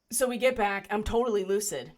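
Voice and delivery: usual speaking voice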